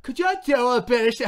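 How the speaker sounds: loud, high-pitched hammed-up British; bad British accent. Comedian answering the BBC request, botched British accent. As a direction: attempt a British accent, badly, nearly laughing. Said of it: "I wish I could do a British accent".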